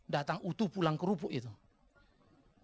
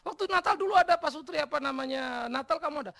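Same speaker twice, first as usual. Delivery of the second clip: high, raised-voice retold questioning; tanya kaget; 10 semitones higher, 7 dB louder than usual. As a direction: re-enact an alarmed check, pitched well up. Menirukan tanya cemas kepada jemaat. (bertanya kaget, suara tinggi)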